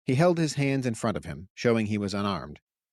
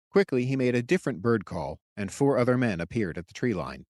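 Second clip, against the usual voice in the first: usual speaking voice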